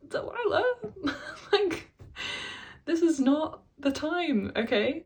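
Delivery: high, loud incredulous protest through laughter; laughing exasperation. Acted. Scolding Delilah for getting drunk as things go wrong, amused exasperation.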